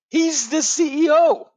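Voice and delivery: high, loud, drawn-out exclamation; amazed reveal. Real, not acted. Host revealing the hockey player's job, amazed. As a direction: stretch it out in thrilled disbelief.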